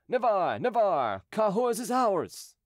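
loud, high victory cry. A narrator's reading. Hundred men rushing the breach, crying out. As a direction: shout it high, stretching the last words.

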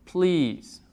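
"please" with a long ee; "please" drawn on one ee sound; ea as Spanish i. Shown as a demonstration. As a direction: model "please" softly as "pliis". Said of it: "Pongamos otro ejemplo".

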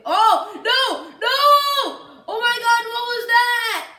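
high, loud real alarm; panicked shout. Real, not acted. Gamer hit by a surprise attack, panicked shout. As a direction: yell it out in shock, pitch jumping up.

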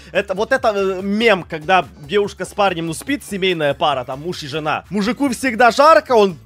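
usual speaking voice

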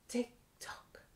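whispered "tick tock", barely voiced. Teacher whispering "tick tock" at the end for toddlers.

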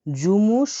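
জুমুসচু said clearly as "jumuschu"; model pronunciation. Shown as a demonstration. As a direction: say জুমুসচু slowly, each syllable clear. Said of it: "শ্রমিক বা কর্মচারীকে বলা হয় হলো".

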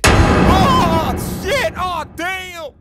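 high, loud startled shriek; terrified scream. Acted. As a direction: shriek at his own reflection. Sees monster in the mirror.

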